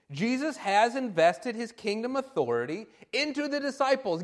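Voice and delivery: normal talking voice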